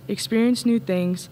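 ordinary talk, nothing marked

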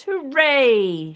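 loud, high cheer of joy; captain shouts. Acted. Pirate captain shouting to the crew, loud and high. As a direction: shout hooray across the bay. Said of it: "The captain shouts".

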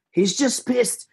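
high Scottish accent impression; squeaky Scottish accent, Mike Myers style. Texan musician imitating Mike Myers Scottish accent. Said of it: "my Scottish impression is just Mike Myers".

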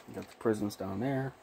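usual speaking voice

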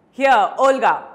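loud summoning call. Acted. Advocate summons his cook from the kitchen, calling out. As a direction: call a servant from another room.

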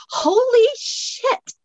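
high, drawn-out startled cry. Acted. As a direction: jump out of your skin at the moving wall. Startled by moving bookcase.

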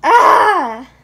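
loud, high scream of frustration; frustrated yell. Acted. Child whose drawing went wrong, frustrated scream. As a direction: let out a loud, angry "Ah!". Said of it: "when you feel like yelling".

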